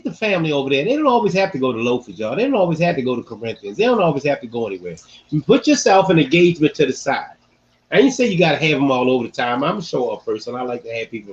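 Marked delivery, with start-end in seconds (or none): none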